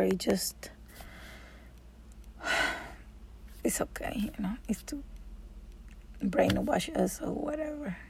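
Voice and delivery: hushed confidential remark; whispered aside. Real, not acted. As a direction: whisper it, a bit fast. Whispered aside about fear.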